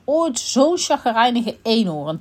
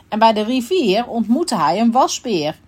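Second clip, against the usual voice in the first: ordinary delivery, nothing marked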